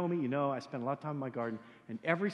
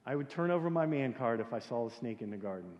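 normal talking voice

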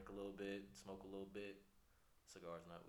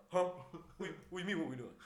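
put-on scared kid voice; 7 semitones higher, 7 dB louder, much faster than usual. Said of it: "Looked at me, eyes big as hell".

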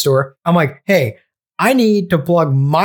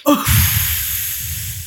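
long breathy sigh of frustration; exasperated sigh; 10 semitones higher, 6 dB quieter than usual. Retold or imitated. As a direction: let out a heavy, defeated sigh. Frustrated moment when no sound plays.

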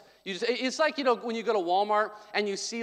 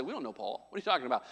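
dismissive church; 7 semitones lower, 8 dB quieter, much faster than usual. Retold or imitated. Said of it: "didn't say".

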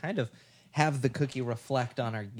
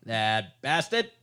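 put-on slow old-timey grumble; old-timey gangster; 2 semitones lower, slower than usual. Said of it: "old timey".

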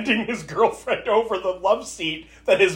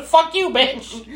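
mock catfight; 10 semitones higher, much slower than usual. Reenacting two girls quarreling.

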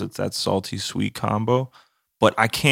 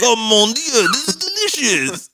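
high, hammed-up French accent; loud, high mock-French delight; rich Frenchman; 5 dB louder than usual. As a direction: play an overjoyed rich Frenchman, pitched way up. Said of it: "He's like, some rich guy".

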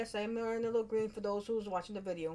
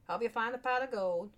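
higher-pitched mock Irish accent; attempted Irish accent; 4 semitones higher, 3 dB louder than usual. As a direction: wish it in a put-on, off-target brogue. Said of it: "What kind of accent am I trying to do there?".

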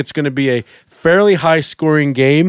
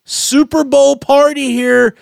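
high, slow hype announcer; pumped-up host intro; 8 semitones higher, slower than usual. Acted. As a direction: build it up like a game-day host. Hyping Super Bowl segment.

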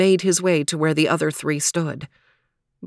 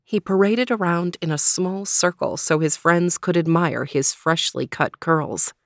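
ordinary delivery, nothing marked

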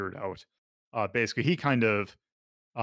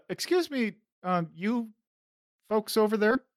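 calling out; 10 semitones higher than usual. Acted. Receptionist calling across the lobby.

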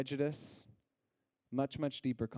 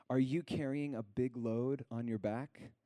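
scripture reading. Acted. Pastor to congregation, gently reading scripture aloud. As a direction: read it like a gentle invitation.

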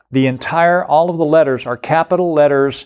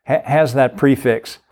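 normal talking voice

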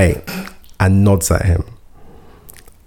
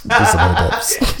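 laughing; 12 semitones higher than usual. Retold or imitated. Laughing repeat, slower and higher.

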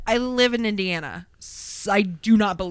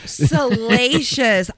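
slow, savoured, louder echo; drawn-out relish; 4 semitones higher, 6 dB louder, much slower than usual. Pretend. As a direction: stretch the word out with glee. Echoing the juicy word slowly.

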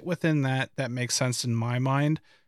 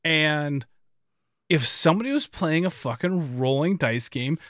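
normal talking voice

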